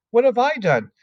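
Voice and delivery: put-on puzzled child's protest; girl asks what she did wrong; Matilda. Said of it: "Matilda said".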